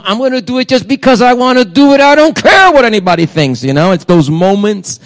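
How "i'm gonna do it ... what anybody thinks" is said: higher-pitched defiant outburst. Retold or imitated. Pastor voices someone giving in to urges, mock defiant. Voicing a defiant self-indulgent moment.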